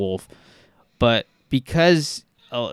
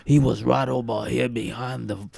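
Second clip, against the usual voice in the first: Cajun witness. Retold or imitated. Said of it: "hearing people talk about it".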